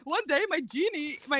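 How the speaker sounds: ordinary talk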